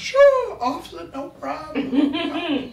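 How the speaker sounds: hammed-up polite elderly woman; elderly driver answering the cop; sweet old lady. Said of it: "She said".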